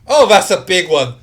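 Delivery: loud, high cry of alarm; excited battle holler; in-character shouting. Acted. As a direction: yell it out mid-fight. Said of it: "And also goes".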